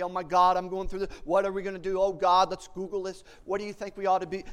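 hammed-up panic of worried believers; frantic. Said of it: "they're franticking".

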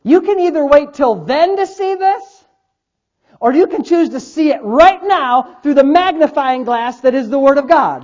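forceful, measured sermon delivery; emphatic preaching. Real, not acted. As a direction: press the choice on the congregation.

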